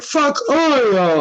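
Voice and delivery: sudden high-pitched exclamation; disgusted outburst. Retold or imitated. Hungry patient reacting to a bad cheeseburger, disgusted yelp. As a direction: spit it out after one bite.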